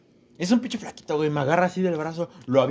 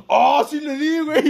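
excited; 11 semitones higher, 6 dB louder, slower than usual. Retold or imitated. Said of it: "Y yo así como de".

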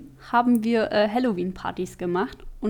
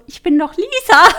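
indignant friend; 9 semitones higher, 13 dB louder than usual. Retold or imitated. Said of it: "und sie so".

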